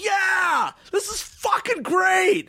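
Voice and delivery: very high, stretched-out mock shout; hammed-up euphoric holler, drawn out; ecstatic yell. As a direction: imitate a friend having the time of his life. Cross shouting happily on dance floor.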